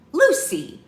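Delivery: high, loud reprimand; scolding shout. Acted. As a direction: call her out sharply. Scolded after Lucy's insult.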